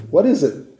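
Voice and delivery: "What is it?" asked high and wondering, stress on "is"; bewildered Israelite asking "What is it?"; puzzled question. Shown as a demonstration. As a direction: ask "What is it?" as if staring at strange food. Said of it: "we're repeating it".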